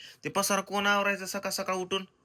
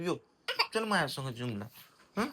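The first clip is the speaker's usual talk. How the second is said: normal talking voice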